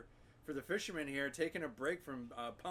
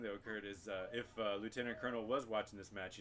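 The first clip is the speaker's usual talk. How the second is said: ordinary talk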